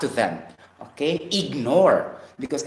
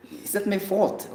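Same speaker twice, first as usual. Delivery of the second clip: usual speaking voice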